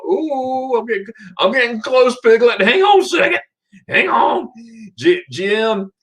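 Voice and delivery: a hint of a cartoon character; playful high voice. Playful high voice reading comment.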